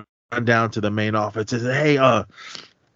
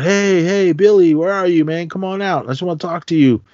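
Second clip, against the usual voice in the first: coaxing call; 7 semitones higher than usual. Retold or imitated. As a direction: lure him out, falsely friendly. Killer calling out searching.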